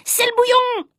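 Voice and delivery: high shouted alarm; warning shout. Acted. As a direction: yell it out to warn the class. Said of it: "il y en a un qui a crié".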